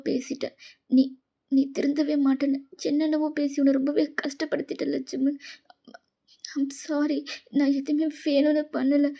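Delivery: tearful, halting confession; remorseful, thin and faltering; crying. Acted. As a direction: plead for forgiveness through tears. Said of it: "எதுக்கு இப்ப அழுவுற?".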